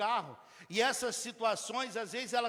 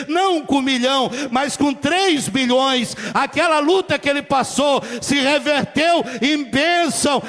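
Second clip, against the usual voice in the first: loud, ringing sermon delivery; full-force preaching; 3 semitones higher, 12 dB louder than usual. Acted. Pastor exhorting the congregation, loud preaching. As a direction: preach it out to the whole church.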